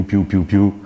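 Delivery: usual speaking voice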